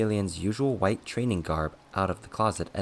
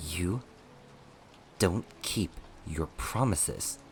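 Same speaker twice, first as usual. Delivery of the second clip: slow, separated words, held back; enunciating each word; 5 dB quieter, slower than usual. A narrator's reading. Servant staring down his master, each word enunciated. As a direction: weigh out every word accusingly. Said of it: "enunciating each word".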